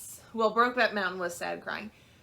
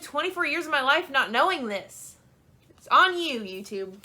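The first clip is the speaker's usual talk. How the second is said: heated rant; 5 semitones higher, 5 dB louder than usual. Real, not acted. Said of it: "I got heated about that".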